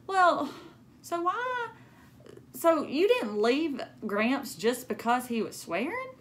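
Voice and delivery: high, puzzled question; lightly voiced surprised girl; surprised young girl. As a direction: ask it like a curious kid. Narrator girl asks Gram.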